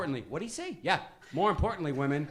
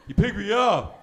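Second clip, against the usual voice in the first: put-on slow slurred teen voice; lightly voiced lazy teenager repeating; mumbling teenager; 7 dB louder, slower than usual. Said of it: "I can't understand him".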